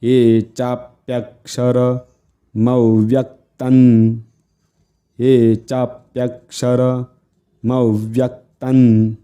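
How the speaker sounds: slow chant with a break mid-line; very slow recitation. Shown as a demonstration. Teacher shows students the verse, very slowly. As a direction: stretch each syllable, pausing halfway. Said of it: "एकदम सावकाश".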